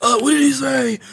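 imitating fans. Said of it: "They're like".

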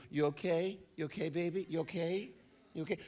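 hammed-up parent soothing a baby; mock coddling. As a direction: coo it like soothing an infant.

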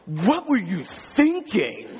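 slow, drawn-out mock exasperation of a bossy kid; drawn-out, bossy telling-off in a retold voice; bossy older sibling. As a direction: imitate a child scolding a younger sibling, word by word. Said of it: "controlling and bossy".

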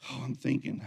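ordinary delivery

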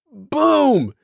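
a bright Boom! to cap the story; triumphant. Real, not acted. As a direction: slam the punchline home. Punchline of the story.

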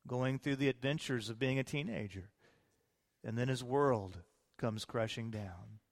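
ordinary delivery

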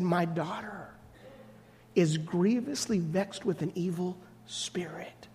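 a mother whispering her plea to Jesus; hushed plea. Acted. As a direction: breathe the words out like a worn-out mother. Woman pleading to Jesus.

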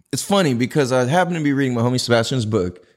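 usual speaking voice

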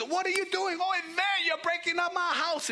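very high-pitched, frantic outcry; upset homeowner. Acted. House owner yells up at the diggers, comically upset. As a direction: squawk in comic outrage at the damage. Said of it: "getting all excited and getting all upset".

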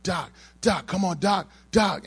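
high, imitated cry for help; urgent calling out. Teammate behind the injured lifter calling Doc over, high urgent cry. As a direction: call urgently for the medic.

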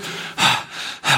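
heavy acted sigh; imitated sigh of frustration; mock exasperated sigh. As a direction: sigh as if fed up. Imitating the confused, frustrated professor.

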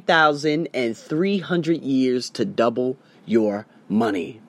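slow, deliberate, word by word; really slowly. Shown as a demonstration. Vlogger slowing down to stress a key point, deliberately. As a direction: spell it out slowly so it sinks in. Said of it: "I'm gonna say this really, really slowly".

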